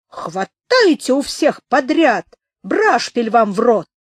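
high, loud commanding yell; barking orders. A narrator's reading. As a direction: bark orders at the crew. Sailor barking orders at men.

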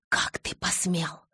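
slow, whispered fury; outraged hiss. A narrator's reading. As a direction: whisper it slowly, appalled. Outraged mother confronts Jacob.

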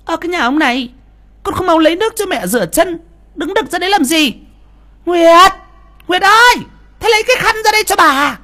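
wife showing off for mother, shrill and loud; yelling wife. Acted. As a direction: bark orders at husband and call Nguyệt. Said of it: "quay ra quát".

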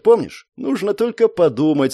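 plain reading voice

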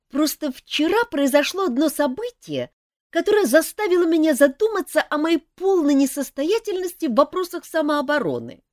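put-on young elf prince. A narrator's reading. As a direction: confess it seriously but eagerly. Принц-эльф рассказывает Жаку. (молодой принц-эльф, серьёзно, выше и громче)

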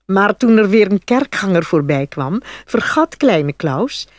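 ordinary delivery, nothing marked

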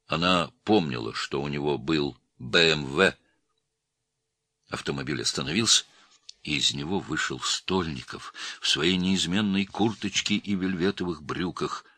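ordinary delivery, nothing marked

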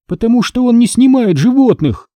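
high, emphatic comeback; blurted retort. A narrator's reading. Hannah answering why he won't photograph him, blurting. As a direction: fire back in a high voice. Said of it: "выпалила Ханна".

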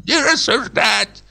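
high, thin quavering command; rattling old voice. A narrator's reading. Old bank director on the sofa, rattling. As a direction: rattle it out like a frail old man. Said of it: "дребезжал".

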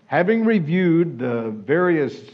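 normal talking voice

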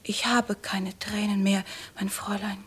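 cried-out, only breath left; exhausted whisper. Acted. Madelon tells the lady she has no tears left, whispering.

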